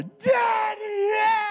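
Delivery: high, loud wailing cry of Daddy; crying scream. Retold or imitated. Pastor retells his daughter chasing his truck, high crying scream. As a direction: wail it like a small child sobbing for her father.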